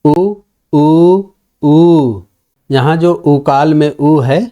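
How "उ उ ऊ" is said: short उ, then longer उ, then drawn-out ऊ; teacher demonstrating short, long and prolonged u; three vowel lengths. Shown as a demonstration. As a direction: stretch each उ longer than the last. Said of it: "तीन प्रकार के उ".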